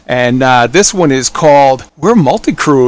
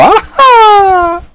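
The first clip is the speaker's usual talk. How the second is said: high whoop; 3 dB louder than usual. Pretend. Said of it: "No, I'm just playing with y'all".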